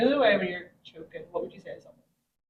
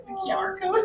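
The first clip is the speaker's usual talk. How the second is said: high Derry lilt, laughing; Derry QR code while laughing; Londonderry accent; 10 semitones higher than usual. Retold or imitated. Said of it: "very Londonderry accent".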